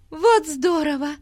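high, thrilled cry of joy; delighted exclamation. A narrator's reading. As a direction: burst out with delight. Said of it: "с восторгом воскликнула Бетси". (восторженный возглас)

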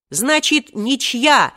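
high, gleeful shout after them; triumphant call. A narrator's reading. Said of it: "торжествующе крикнул им вслед".

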